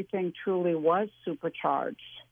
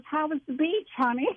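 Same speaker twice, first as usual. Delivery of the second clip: casual mom; 8 semitones higher than usual. Retold or imitated. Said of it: "just casually says".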